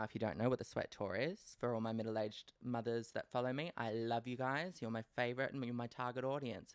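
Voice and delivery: ordinary delivery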